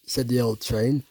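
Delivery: usual speaking voice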